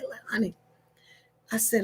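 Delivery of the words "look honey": soft whispered aside. Retold or imitated. Wife whispering to calm husband. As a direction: hush him gently.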